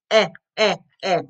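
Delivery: "Eh" as a short vowel, repeated separately; short E sound. Shown as a demonstration. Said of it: "What's the E sound".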